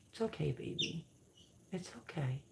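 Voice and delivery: soft, hushed reassurance to a squealing chick; soothing hush. Real, not acted. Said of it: "talk like that, they calm down".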